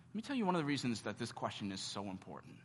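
ordinary delivery

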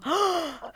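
a high, startled gasp; sharp gasp. Retold or imitated. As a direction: gasp in sudden shock. Hearing the admission result arrives. (högt, häpet flämtande)

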